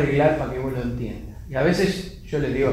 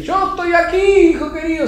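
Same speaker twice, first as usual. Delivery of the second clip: voice of God; 14 semitones higher, 6 dB louder than usual. Acted. God answering the fallen climber, booming call. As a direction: call down grandly from above. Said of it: "escucha que Dios le dice".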